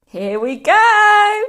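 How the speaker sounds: long, high, loud launch call; crying out. A narrator's reading. George giving Grandma her medicine, excited cry. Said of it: "George cried out".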